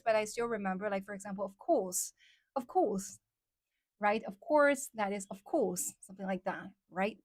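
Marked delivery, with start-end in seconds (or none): 1.4-3.2 British "of course" with the r dropped; British accent. Shown as a demonstration. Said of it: "I couldn't really do it very well". 4.2-4.9 American "of course" with a sounded r, pitched up; American accent. Shown as a demonstration. American take against British one. 5.3-5.9 British "of course", r dropped and long vowel; British accent. Shown as a demonstration. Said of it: "I used to have, like, really, uh, strong British accent".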